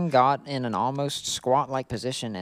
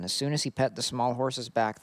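ordinary talk, nothing marked

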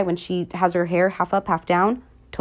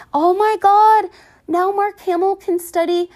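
excited shriek; 14 semitones higher, 6 dB louder than usual. Real, not acted. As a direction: squeal it like a thrilled fan. Fangirling over Luke's look.

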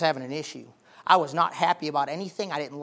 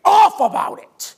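angry shout; 7 semitones higher, 6 dB louder, slower than usual. Acted. Voicing Ezekiel's anger.

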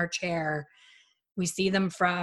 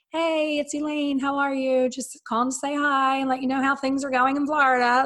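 acted friendly phone greeting; 7 semitones higher, 3 dB louder than usual. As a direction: act out a bubbly catch-up call. Acts out her cheerful phone call.

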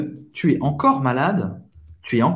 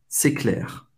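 whispered, softer c'est clair; 3 semitones lower, 4 dB quieter than usual. Shown as a demonstration. Teacher to students, repeating c'est clair in a whisper. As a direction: breathe c'est clair without voice. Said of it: "Outra expressão".

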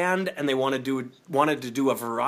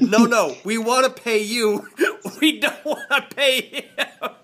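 laughing quote; 7 semitones higher, 4 dB louder than usual. Retold or imitated. Quoting elders while laughing.

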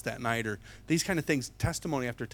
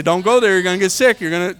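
loud warning; 6 semitones higher, 15 dB louder, faster than usual. Retold or imitated. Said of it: "People say".

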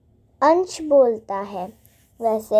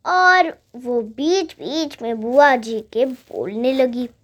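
plain reading voice

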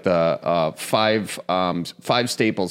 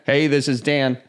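chipper phone greeting, a bit higher; Dan answering phone; 7 semitones higher than usual. Retold or imitated. Mimicking buddy Dan's cheery greeting when he answers the phone. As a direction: answer brightly, same every time. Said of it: "it just doesn't matter".

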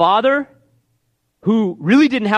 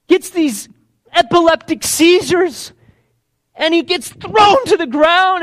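desperate plea; 6 semitones higher, 3 dB louder than usual. Acted. Desperate father pleading to Jesus.

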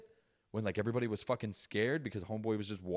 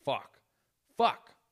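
mounting frustration; 4 dB louder, much slower than usual. Retold or imitated. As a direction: escalate each curse, mimicking fed-up cops. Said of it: "every day they're like".

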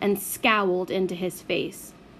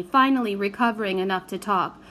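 ordinary delivery

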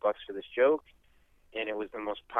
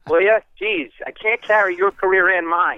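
loud mock-annoyed brush-off; mock exasperation; 4 semitones higher, 6 dB louder than usual. Lawyer mock-scolding Leno on the phone, loud. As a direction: shoo off a celebrity, mock fed up. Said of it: "I go".

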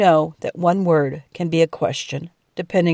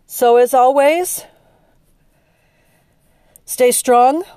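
higher and louder, rising on always; rousing sign-off; 6 semitones higher, 7 dB louder than usual. Real, not acted. Podcaster sending listeners off, high and loud. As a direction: lift the voice for a rallying farewell.

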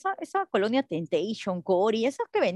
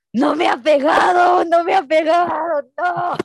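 put-on loud, high, stretched-out child's cry; hammed-up tattletale howl; mock wail; 7 semitones higher, 7 dB louder, slower than usual. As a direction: wail it out like a kid telling on mum. Acting her child self wailing to father.

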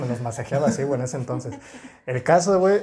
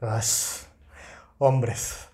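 lightly voiced, lower disdainful scoff; mock a teacher giving up on men; exasperated teacher; 4 semitones lower, 4 dB quieter than usual. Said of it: "la maestra así de que". (maestra fastidiada, imitada levemente)

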